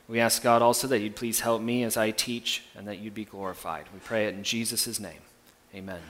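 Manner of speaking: soft, low reverent prayer. Real, not acted. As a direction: pray quietly and humbly. Pastor leads prayer.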